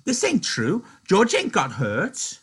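high, loud slow protest; Lenny protesting. Acted. Lenny protesting to Crooks that George is fine. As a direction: insist it cannot be true.

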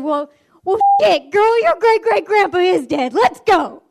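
high, loud, no-nonsense outburst; exasperated scolding. Retold or imitated. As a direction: imitate a fed-up teacher ordering a pupil out. Exasperated Mister Jones hauls pupil out.

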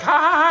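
high-pitched mimicked "ha ha ha"; imitated laughter. Imitating Taliban fighters on gym machines, mock laughter. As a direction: imitate gleeful laughing on a high held note. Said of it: "they're laughing".

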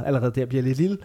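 ordinary talk, nothing marked

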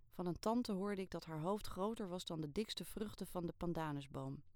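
ordinary delivery, nothing marked

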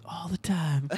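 quiet smug reply; a hint of a sly Seagal grin. Seagal slyly answering the guy, quiet smug. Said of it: "slyly smiles and goes".

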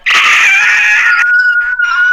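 long, very high raptor shriek; raptor screech. Shown as a demonstration. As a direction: let out a loud, drawn-out dinosaur screech. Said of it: "a raptor was more like".

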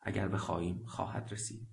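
very soft, calm reply; hushed, understated answer; quietly. A narrator's reading. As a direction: say it calmly under your breath. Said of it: "آرام گفت".

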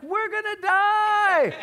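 high, loud, drawn-out cry; imitated little girl yelling slowly; same child's scream. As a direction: stretch the last word into a shriek. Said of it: "she's saying the exact same thing".

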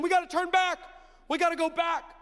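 frightened crowd wanting back; panicky high voice. Retold or imitated. Scared people panic.

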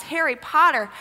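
ordinary delivery

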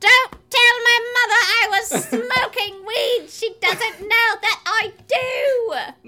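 put-on drawn-out, overdramatic child voice; hammed-up pleading child. Child hiding weed from mother, hammed-up pleading. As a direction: plead theatrically like a naughty child.

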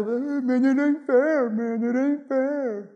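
mock whining, stretched out slowly; imitating a sulky teen griping; whiny complaint. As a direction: whine it like a sulking kid. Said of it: "Estuvieras chillando".

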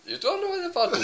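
high-pitched mock-Indian disbelief; incredulous Indian voice; Indian accent.

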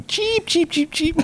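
bird chirp. Pretend. Imitating a bird.

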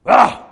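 short loud snarling cry; mock angry growl. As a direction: growl it like gnashing teeth. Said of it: "gnashed their teeth at him".

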